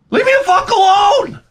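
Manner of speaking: high, loud blow-up; furious shout. Retold or imitated. Snapping at the people pushing the vaccine, furious shout. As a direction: explode at them, done with it.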